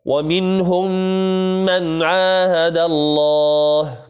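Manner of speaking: slow chanted recitation, merged mim held with ghunna; Quran recitation. Shown as a demonstration. Teacher recites the example verse, drawn out and chanted. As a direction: recite slowly, stretching ومنهم into من. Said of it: "وقول الله سبحانه وتعالى".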